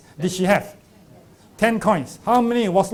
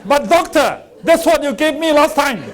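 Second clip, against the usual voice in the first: indignant protest; 8 semitones higher, 8 dB louder than usual. Retold or imitated. Patient protests in story.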